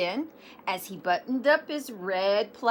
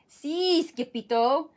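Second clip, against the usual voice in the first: panting; 6 semitones higher than usual. A narrator's reading. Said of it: "panted Poquito Tito".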